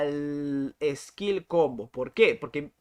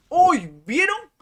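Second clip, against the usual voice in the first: startled yelp; 12 semitones higher, 10 dB louder, slower than usual. Real, not acted. Streamer hit by lag, yelping to his viewers in shock. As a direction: jump way up in pitch, loud and sudden. Lag spike hits him live.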